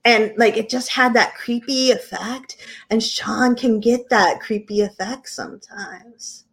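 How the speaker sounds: ordinary delivery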